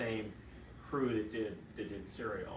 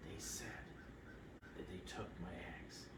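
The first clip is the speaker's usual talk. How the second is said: softer hushed whisper, leaning in; conspiratorial whisper; 4 semitones lower, 14 dB quieter than usual. Retold or imitated. Sedated patient confiding to her doctor, hushed whisper. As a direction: whisper a secret she believes. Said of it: "She goes".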